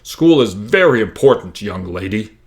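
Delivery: lightly voiced stern principal, slow and loud at first; mild mock principal scolding; principal imitation. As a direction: lecture him like the prim principal, slowly. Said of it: "perfect imitation of our principal".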